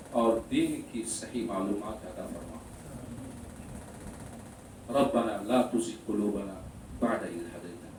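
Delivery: low, subdued supplication; closing prayer. Real, not acted. Preacher asking Allah to guide everyone, low prayer. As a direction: pray softly in short, paused phrases.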